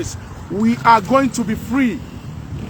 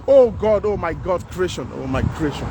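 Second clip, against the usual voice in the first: anguished lament; 6 semitones lower than usual. Real, not acted. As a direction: sink down into a despairing moan. Lamenting suffering of people.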